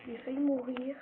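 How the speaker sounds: loud rush of relief; loud exclaim. Real, not acted. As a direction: blurt it out after a near miss. Near death, loud.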